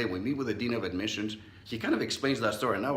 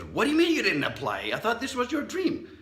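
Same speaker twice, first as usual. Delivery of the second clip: uncle's furious disbelief, higher and louder; 12 semitones higher, 5 dB louder than usual. Retold or imitated. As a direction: demand it like an outraged uncle. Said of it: "And he got furious".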